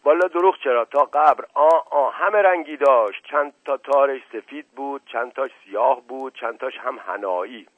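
garrulous servant, emphatic opening that eases off; earnest tale-telling servant. Acted. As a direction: protest loudly, then settle into listing details. Narrator plays the servant Mashghasem answering.

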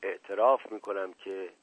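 a stifled, beaten confession; muffled voice. Acted. Asadollah confessing to the inspector in a muffled, subdued voice.

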